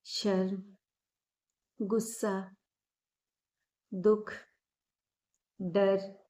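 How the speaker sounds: calm, unhurried meditation guide with long pauses; measured, soothing guidance, drawing out the breathing cues; guided meditation. Acted. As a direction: guide them gently inward, phrase by phrase.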